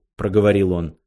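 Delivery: usual speaking voice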